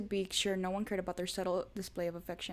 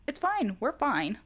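high, loud flustered blurt; nervous fluster; 6 semitones higher, 7 dB louder, slower than usual. A narrator's reading. Pushy waitress leaning close, loud nervous fluster. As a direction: stumble over it in a panic. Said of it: "laughing nervously".

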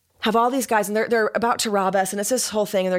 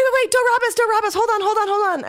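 much higher frantic pleading to robbers; frantic plea; 13 semitones higher, 3 dB louder, faster than usual. Retold or imitated. To the robbers about to rob her, high frantic pleading. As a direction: beg them to stop, high and urgent.

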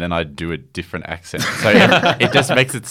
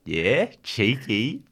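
slow, higher, drawn-out mateyness; cheeky prank caller; 5 semitones higher, much slower than usual. Pretend. Prank caller slipping in his code word, slow cheeky teasing. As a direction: stretch it out as a slightly put-on teasing mate. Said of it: "I'll say cheeky".

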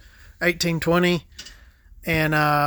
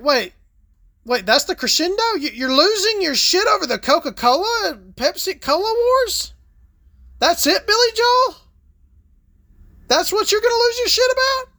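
incredulous, much higher pitched questioning; mock disbelief; 13 semitones higher than usual. Listener reacting to [name]'s crescendo, high mock disbelief. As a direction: act baffled that this is the big finale. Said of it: "And you're like".

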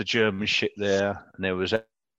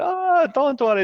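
much higher, put-on French accent; mock French accent, pitched right up; accidental French accent; 14 semitones higher than usual. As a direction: reassure in a hammed-up French accent. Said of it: "I've just turned into a Frenchman there".

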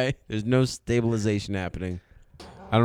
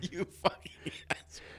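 whispered curse; 6 semitones higher, 16 dB quieter than usual. Real, not acted. Laughing friend swears at him.

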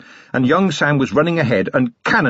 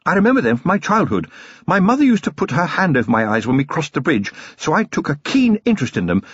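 lightly raised, refined lady's voice; a fond, well-bred wife; Lady Sybil, genteel lady; 5 semitones higher than usual. Acted. Wife cutting in on her husband, refined lady.

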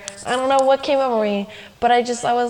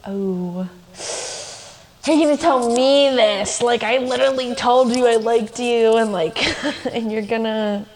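exasperated disbelief; 4 dB louder than usual. Retold or imitated. To her crush, who just named another girl, mock outrage. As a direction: throw it back at her half-laughing, incredulous. Told she has a crush on someone else.

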